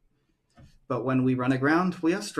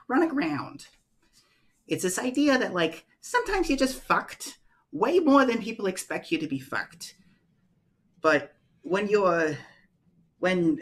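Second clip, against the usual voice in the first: lightly voiced Elmira; 6 semitones higher than usual. Elmira explaining in character.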